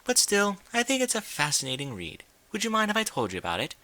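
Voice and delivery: a hint of a refined high lady voice, eager; lightly voiced Rarity. A narrator's reading.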